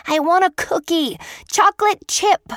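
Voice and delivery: put-on a boy dashing to the display case, thrilled; excited child shout. A narrator's reading. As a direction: yell it like a kid racing to the sweets. Boy runs for the cookies.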